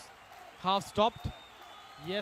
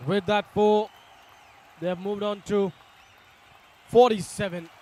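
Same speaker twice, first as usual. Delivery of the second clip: excited; 3 semitones higher, 9 dB louder than usual. Real, not acted. Still buzzing after the boundary.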